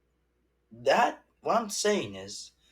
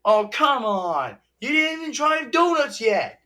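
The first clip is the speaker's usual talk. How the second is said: imitating RJ; 9 semitones higher, 5 dB louder than usual. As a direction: squeak it out like the cartoon raccoon. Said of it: "R J's like".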